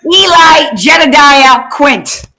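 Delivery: slow, loud grand announcement; proclaimed full name. Acted. Fan proclaiming Quint's full name to co-host, slow and loud. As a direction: boom the full name out like a herald.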